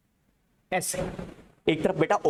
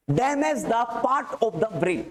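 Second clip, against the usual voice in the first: drawn-out dictation, word by word; slow dictation; 3 semitones higher, much slower than usual. Real, not acted. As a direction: dictate slowly for students writing it down. Dictating a heading for students to write.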